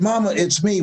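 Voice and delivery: high-pitched plea to mother; acted cry of a child begging recognition; pleading child. Child being beaten by his mother, acted high pleading.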